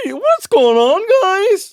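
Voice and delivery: imitating Michael. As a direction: greet the guys in a high voice. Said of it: "Michael was saying to me".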